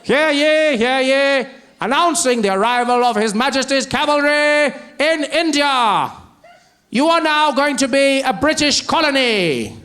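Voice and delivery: loud, high proclamation in a British crier voice; pompous British herald calling out. Retold or imitated. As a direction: announce it like a royal town crier. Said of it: "The cavalry arrived".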